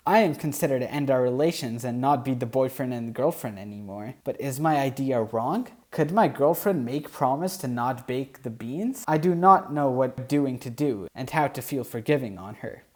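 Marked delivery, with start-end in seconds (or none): none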